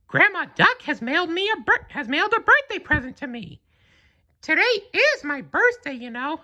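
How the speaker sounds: high falsetto cartoon mouse, cheerful; Mickey Mouse voice. A narrator's reading. Mickey explaining his birthday present, high and cheerful. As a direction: chirp it out like Mickey.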